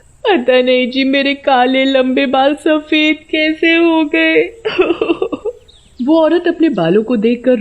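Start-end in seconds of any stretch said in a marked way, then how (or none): 0.0-4.6 high, slow whining lament; wailing wife. A narrator's reading. 4.7-5.5 high eerie cackle; witch cackle. A narrator's reading.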